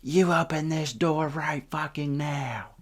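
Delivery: high, slow mock-angry demand; imitated pissed mom. Moms demand entry at the door, mock anger. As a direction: put on a high irate Karen voice. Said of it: "sitting there looking pissed".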